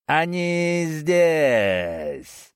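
very slow, drawn-out booming shout; happy giant yell. Acted. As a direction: stretch it out in glee. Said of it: "счастливый вопль". (радостный громовой вопль великана)

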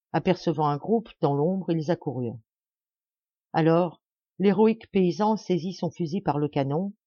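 usual speaking voice

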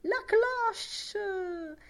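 very high, gleeful cheer; jubilant cry. A narrator's reading. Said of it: "gridò Lenehan giubilante". (grido giubilante, acutissimo)

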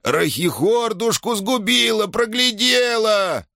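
very high, slow trembling squeal; shrieking old shaman. A narrator's reading. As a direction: squeal at her in outrage.

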